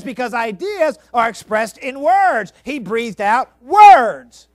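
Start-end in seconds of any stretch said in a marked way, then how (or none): 2.6-4.5 slow, much louder, each word pressed; preaching shout. Real, not acted. Preacher drives the point home, slow and much louder. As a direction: pound the line out slowly.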